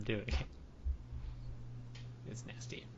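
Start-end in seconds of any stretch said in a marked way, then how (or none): 2.3-2.8 breathy whispered aside; whispered disgust. Real, not acted. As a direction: whisper it, grossed out. Disgusted aside after gummy bears.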